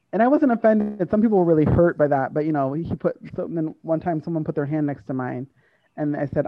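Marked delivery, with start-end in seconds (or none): none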